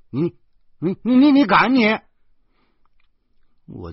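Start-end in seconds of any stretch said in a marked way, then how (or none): 0.1-2.0 much higher and louder, stuttering in alarm; sputter, panicked and outraged; flustered stammer. Acted. Guardian sputtering at the girl about to jump, alarmed.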